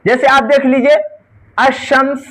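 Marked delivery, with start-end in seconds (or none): 1.6-2.3 अशम्स said as ash-shams, lam not sounded; अशम्स as one word, no l sound; silent lam. Shown as a demonstration. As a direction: say अशम्स with the lam dropped and the sh doubled. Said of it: "लाम का pronunciation नहीं हुआ".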